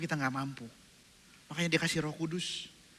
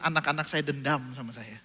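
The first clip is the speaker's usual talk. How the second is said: normal talking voice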